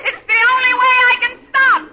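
high, loud call back; desperate shout. Acted. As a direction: yell it while hurtling downhill. Shouting back before crashing.

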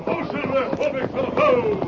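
high calling shout; calling to horse. Acted. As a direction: rein in the horse. Stopping horse.

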